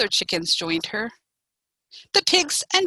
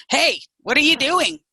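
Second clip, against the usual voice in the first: loud, raised call; hollering; 5 semitones higher, 6 dB louder than usual. A narrator's reading. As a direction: holler over to the dancers. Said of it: "he hollered".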